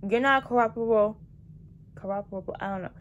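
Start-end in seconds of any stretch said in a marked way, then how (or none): 0.1-1.2 scolding. Retold or imitated. Retold scolding continues.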